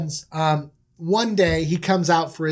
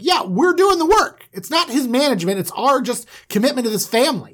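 raised, forceful objection; indignant protest; 5 semitones higher, 3 dB louder than usual. Acted. Brothers protesting to Dad about their work, indignant. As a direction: push back hard, pitched up. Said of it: "And they're like".

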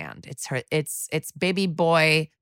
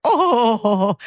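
higher, drawn-out mock moan; 4 semitones higher, 4 dB louder than usual.

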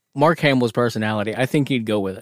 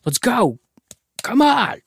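mock pushy buddy; 6 semitones higher, slower than usual.

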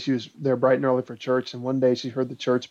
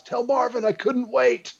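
mock yell; 10 semitones higher than usual. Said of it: "he yells inside the house".

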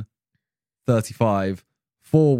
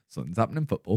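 mimicked northern accent, softer; northern English accent; 6 dB quieter, much faster than usual. Co-host echoing the northern-sounding line, mock northern accent. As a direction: echo it back as a northern English impression. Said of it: "That sounded so northern".

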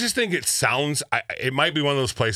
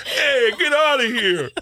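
high, drawn-out New York heckle; botched New York accent; 12 semitones higher, 4 dB louder, slower than usual. Acted. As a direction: slip into hammed-up New Jersey tough talk. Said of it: "that's New York crap".

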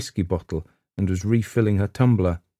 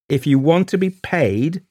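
raised voice, pitched higher and louder; crying out; 10 semitones higher, 4 dB louder than usual. A narrator's reading. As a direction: cut in sharply over her. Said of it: "cried Gerald".

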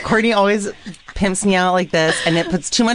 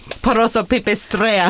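mock Russian accent. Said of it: "I don't know why I did a Russian accent".

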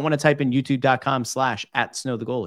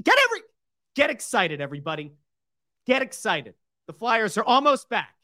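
excited hype; 10 semitones higher than usual. Real, not acted. Hyped sign-off.

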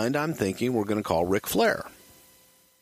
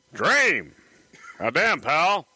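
Dusty Rhodes impression; 8 semitones higher, much slower than usual. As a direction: greet him like Dusty Rhodes. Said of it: "I might do a decent Jim Barnett. I mean, uh, Dusty Rhodes".

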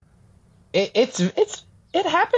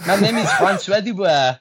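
higher-pitched attempt at a French accent; 5 semitones higher than usual. Shown as a demonstration. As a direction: put on a hammed-up French accent. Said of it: "in a full French accent".

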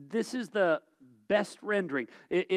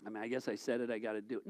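low, much softer reluctant grumble; mock grumbler who only keeps his word out of duty; grudging mutter; 8 semitones lower, 11 dB quieter, faster than usual. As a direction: drag the words out like a chore.

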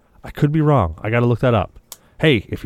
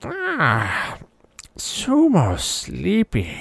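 Furby voice; 8 semitones higher, 3 dB quieter, much slower than usual. Retold or imitated. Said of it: "and it goes".

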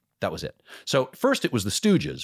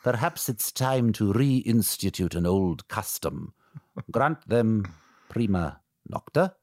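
Braveheart king impression; slower than usual.

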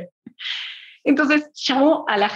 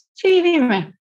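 eager agreement; 7 semitones higher, 3 dB louder than usual. Retold or imitated. Quoting herself agreeing brightly.

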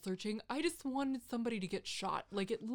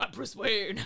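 louder mock Batman growl; Batman stage voice; 7 dB louder than usual. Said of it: "my stage voice".

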